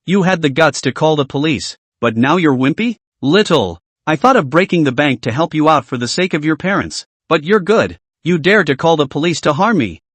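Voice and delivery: usual speaking voice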